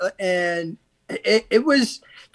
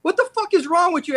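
higher, mock outraged telling-off; incredulous anger; 7 semitones higher than usual. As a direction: scold him in disbelief. Berating the inmate.

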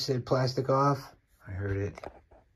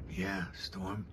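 usual speaking voice

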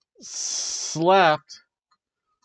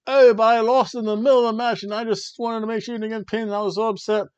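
fast, higher mock pleading; Peyton Royce; 5 semitones higher, faster than usual. Wrestler explaining a lost match to partner, hurried mock pleading. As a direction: rush out an upset excuse in imitation. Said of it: "Peyton Royce was trying to explain herself".

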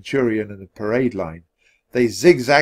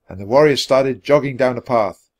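usual speaking voice

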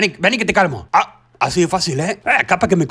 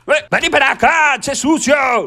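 calling out; 6 semitones higher than usual. Acted. Calling the baby over loudly.

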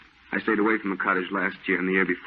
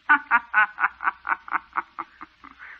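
shrill high insane laughter; mad cackle; 13 semitones higher, 3 dB louder than usual. Acted. Madwoman laughing behind the painter at his easel.

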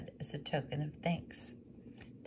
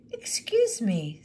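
higher, louder, slow apology; polite widow; 6 semitones higher, 8 dB louder, slower than usual. Acted. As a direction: apologise for the bother. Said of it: "said the widow".

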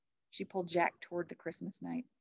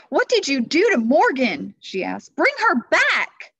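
high, loud demand; furious; 9 semitones higher, 14 dB louder than usual. Acted. Annie confronting the Christmas Knight, furious. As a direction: confront him in a fury. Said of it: "in a fury".

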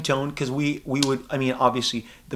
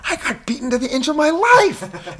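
high, loud hammed-up complaint; a comic indignant Deadpool yelling at Domino; disgusted mock outrage; 13 semitones higher, 5 dB louder than usual. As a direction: whine it like a wronged hero. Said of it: "he's so disgusted with Domino".

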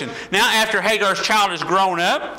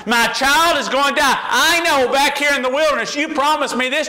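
Hagar's plea; 7 semitones higher than usual. Acted. Preacher voicing Hagar crying to God in the wilderness, anguished. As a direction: voice a mother begging God, breaking into a shout.

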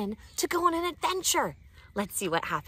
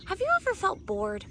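high excited question. Acted. Host asks kids a question.

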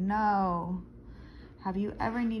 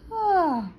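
weary oh; 8 semitones higher, 7 dB louder than usual. Acted. Baker feels so tired.

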